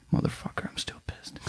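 very soft, whispered grumble; bitter muttering. Real, not acted. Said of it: "hear the bitterness in your voice".

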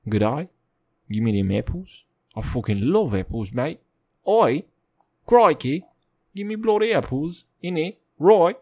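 mock Australian accent. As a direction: play a cartoon Australian begging for apples. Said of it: "she's all of a sudden Australian".

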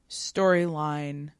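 usual speaking voice

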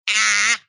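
short loud cry of frustration; exasperated yell. Real, not acted. Exasperated at an implausible plot point.

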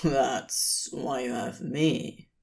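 a hint of a laid-back demon; lazy demon. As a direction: coax him lightly toward coffee. Crowley voiced in story.

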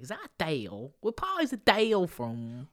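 loud, hammed-up regional British accent; botched British accent. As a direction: overdo a British accent that goes astray. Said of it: "trying to do a British accent".

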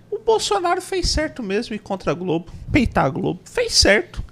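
Zé Carlos do Pátio. Retold or imitated. Guest mimics politician backing Bolsonaro against Globo, high put-on voice. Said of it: "o Zé Carlos do Pátio falando meio assim".

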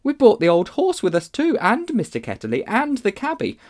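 put-on high, surprised girl's exclamation; crying out. A narrator's reading. Polly discovering the horse came too, high cry. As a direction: cry out as a child who's just noticed. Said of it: "Polly cried out".

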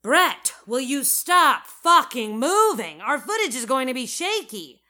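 loud, high-pitched scolding; exasperated outburst. A narrator's reading. Director scolds the fidgety cameraman, exasperated outburst. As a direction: yell at someone in total exasperation. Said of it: "cuts himself off and throws his arms".

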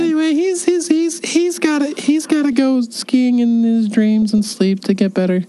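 high, slow put-on grown-up voice; a kid faking a sick note call; fake dad voice. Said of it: "This is a great impression".

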